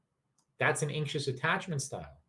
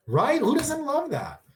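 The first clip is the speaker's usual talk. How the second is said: much higher, drawn-out delighted question; gushing; 13 semitones higher, 5 dB louder, slower than usual. Real, not acted. Streamer gushing to chat, high and delighted. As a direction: swoon over it.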